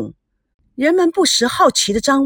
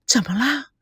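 softer, worried little question; nervous question; 3 semitones higher, 4 dB quieter than usual. A narrator's reading. Bride asking her groom what happened, nervous and soft. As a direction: ask it anxiously, hushed. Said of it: "田心雨紧张地问".

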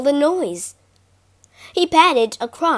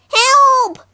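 high, loud cry for help; shrieked plea of a frightened mouse; panicked cry; 13 semitones higher, 8 dB louder than usual. A narrator's reading. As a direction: yelp in alarm. Mouse fleeing from a cat.

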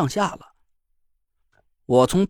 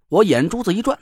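ordinary delivery

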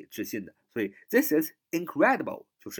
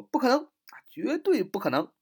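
higher emphatic 绝对不可能; imitating the famous line; 11 semitones higher than usual. Teacher imitating a viral clip's denial, emphatic. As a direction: quote it with mock insistence. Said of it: "一个有名的人啊说不可能啊".

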